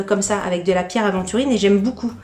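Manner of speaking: ordinary talk, nothing marked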